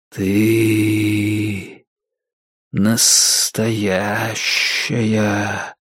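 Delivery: slow, drawn-out ghostly whisper; hushed, lingering otherworldly voice; whispering temple voice. A narrator's reading. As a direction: stretch each word out like an eerie disembodied voice. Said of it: "прошептал безязыкий голос храма".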